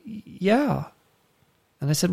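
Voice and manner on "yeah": put-on higher, softer child's yeah; a timid small-girl yes; little girl's yeah. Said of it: "And she said".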